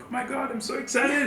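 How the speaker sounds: high, squealing "Oh my God, I'm so excited"; excited girlfriend. Retold or imitated. Imitating his excited girlfriend texting him. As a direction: gush like a giddy girlfriend. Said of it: "Tiffany is always texting me like".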